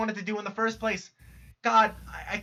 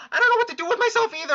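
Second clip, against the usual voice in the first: high, fast, louder tearful rush; crying, rushed; 10 semitones higher, 4 dB louder, faster than usual. Acted. Jade spills her despair, high and rushed through tears. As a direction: blurt it out as the sob rises. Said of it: "this fucking crying's making me lightheaded".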